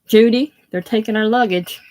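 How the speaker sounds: put-on loud Texan accent calling out. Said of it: "a very Texan accent".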